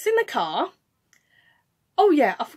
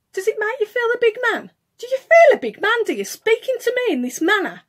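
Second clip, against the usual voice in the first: higher-pitched indignant questioning; retold angry challenge; 6 semitones higher than usual. Challenging the man who spoke down to her, indignant. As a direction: re-enact confronting a bully, voice up. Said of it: "I was quite angry".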